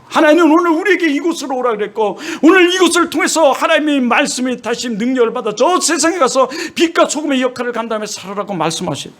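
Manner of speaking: high, impassioned sermon voice; fervent preaching. Acted. Preacher urging the congregation, impassioned and high. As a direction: preach it, rising with conviction.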